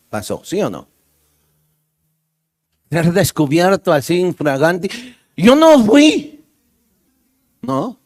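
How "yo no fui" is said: put-on high, slow whiny little-kid voice; mock child pleading innocence; childish denial. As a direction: squeal it like a caught child. Sinner caught, childish denial.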